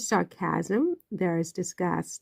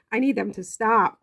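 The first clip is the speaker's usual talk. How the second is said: normal talking voice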